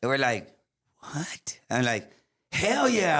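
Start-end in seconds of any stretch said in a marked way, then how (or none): 1.0-1.6 hushed, breath-only disbelief; retold reaction under the breath; stunned whisper. 2.5-3.2 high, eager retold yes; pitched-up, punchy agreement; eager acceptance. Jumping at the band offer.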